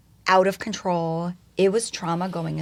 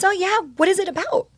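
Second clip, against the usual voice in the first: chirpy volunteer; 12 semitones higher, 5 dB louder, faster than usual. Retold or imitated. Parent volunteer answering her.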